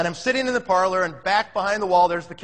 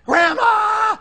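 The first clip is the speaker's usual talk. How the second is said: put-on high joyful shout of little kids; 7 dB louder than usual. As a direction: squeal it like excited grandkids.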